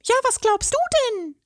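very high, indignant little fairy voice; scolding fairy. A narrator's reading. Fairy scolds the troll, very high and indignant. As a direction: snap back like an offended tiny fairy. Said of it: "schimpfte die zarte Stimme".

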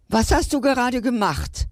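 suddenly wide awake and amazed; astonished. A narrator's reading. Lion, surprised by the paw stroke, asks in astonishment. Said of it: "fragte der Löwe nun erstaunt".

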